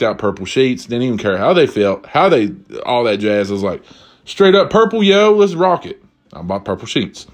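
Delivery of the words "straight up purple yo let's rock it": high-pitched mock enthusiasm; hyped-up decision. Husband picking purple sheets for his wife, hyped up. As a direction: pump yourself up in a raised voice.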